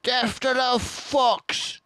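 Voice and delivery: dwarf crying for the fox's death; shouting Bunce. A narrator's reading. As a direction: scream it like a war cry. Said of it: "shouted Bunce".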